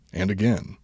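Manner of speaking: ordinary talk, nothing marked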